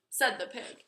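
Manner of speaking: ordinary delivery, nothing marked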